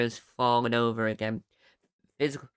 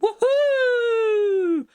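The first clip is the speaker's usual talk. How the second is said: long high loud whoop; excited whoop; 7 dB louder than usual. Real, not acted. Celebrating adverts on videos.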